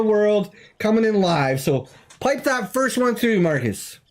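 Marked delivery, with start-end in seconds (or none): none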